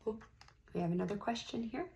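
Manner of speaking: ordinary delivery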